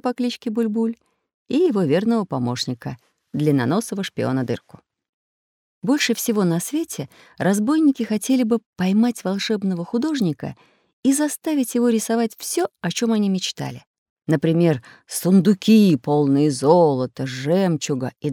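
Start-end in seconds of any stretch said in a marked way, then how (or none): none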